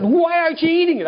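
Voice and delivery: exasperated half-yell over a potato; almost yelling. Retold or imitated. Husband pressing wife about her leftover potato, near yell. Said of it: "I almost yelled at her".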